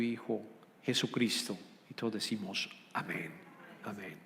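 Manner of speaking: low, soft reverent prayer voice. Acted. Pastor praying before the sermon, soft and low. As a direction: pray quietly to the Father, unhurried.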